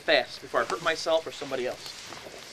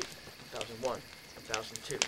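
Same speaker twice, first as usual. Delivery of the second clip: slow, hushed count, much softer; 7 semitones lower, 12 dB quieter than usual. Retold or imitated. Counting to ten while facing the ghost, hushed. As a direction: count it out slowly under your breath. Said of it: "I began to count slowly".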